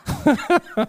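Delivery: a sudden high laugh at the police stop; high burst of laughter. Real, not acted. Preacher laughs while telling of the police stop, high burst of laughter. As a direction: let out one bright, high laugh. Laughing at being pulled over.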